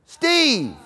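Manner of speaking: lightly voiced wife calling Steve. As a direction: call his name through the door. Wife calls from another room.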